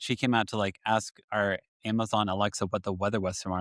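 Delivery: ordinary delivery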